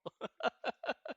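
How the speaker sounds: high-pitched trailing laughter; real laugh. Real, not acted. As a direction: keep laughing, high and helpless. Laughing at not being Dutch.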